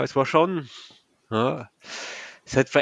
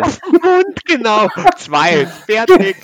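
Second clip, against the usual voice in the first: high, loud giggly voice; laughing; 10 semitones higher, 11 dB louder, slower than usual. Real, not acted. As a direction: laugh through the words.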